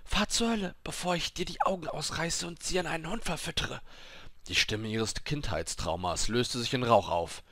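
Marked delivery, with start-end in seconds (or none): 0.0-3.8 high, tense threatening voice; angry threat. A narrator's reading. Dying woman threatening the voice in her head, high-pitched anger. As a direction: threaten the attacker in a much higher voice.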